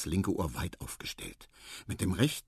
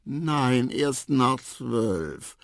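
slow, stretched, higher reply; drowsy murmur; 4 semitones higher, 5 dB louder, much slower than usual. A narrator's reading. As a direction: drag it out as if dozing. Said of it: "murmelte Quawa". (schläfrig gedehnt antworten)

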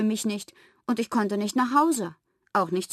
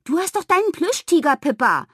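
put-on high, loud child's comeback; indignant retort; 8 semitones higher, 6 dB louder than usual. A narrator's reading. Pippa pointing her friend to the plush tiger, indignant. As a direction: argue back, pitched high and loud.

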